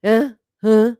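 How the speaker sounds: mimicking a half-asleep girl; dazed, confused huh; drowsy confused huh. Vlogger mocking a groggy streamer, dazed huh. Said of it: "This girl went from literally".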